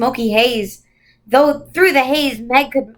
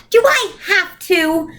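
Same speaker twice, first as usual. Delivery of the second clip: high, slow reluctant plea; reluctant whine; 8 semitones higher, slower than usual. Acted. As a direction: drag it out unwillingly. Reluctant medium pleading.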